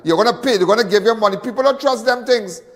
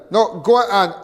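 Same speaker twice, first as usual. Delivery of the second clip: usual speaking voice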